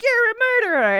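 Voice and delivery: high, indignant accusation; hammed-up horror; mock accusation. Retelling Ben confronting Larry, in a high mock-horrified voice.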